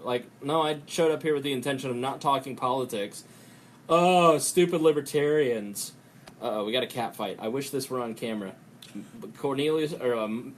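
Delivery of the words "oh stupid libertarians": loud, drawn-out scornful groan; hammed-up disdain, leaning hard on the first word; mocking relative. Family member mocks his politics, loud mock scorn. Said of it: "mocking me for talking politics".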